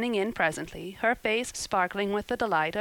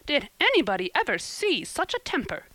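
high, scandalized exclamation; aghast, pitched-up outcry; horrified; 8 semitones higher than usual. A narrator's reading. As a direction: exclaim in horror. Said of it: "exclaimed the horrified Missus Rachel".

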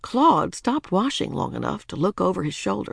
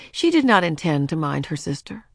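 plain reading voice

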